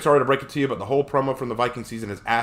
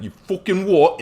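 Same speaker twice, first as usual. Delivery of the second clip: higher, louder mock British; thick British accent; 7 semitones higher, 5 dB louder, slower than usual. Imagining Harry swearing, thick mock British accent. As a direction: put on a thick British outburst. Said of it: "in a thick British accent".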